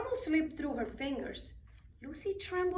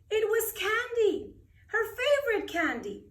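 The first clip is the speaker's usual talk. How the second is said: bright, louder, higher delight; 8 semitones higher, 4 dB louder than usual. A narrator's reading. Narrator sharing the child's delight, bright and lifted. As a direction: let the joy burst out as she sees it.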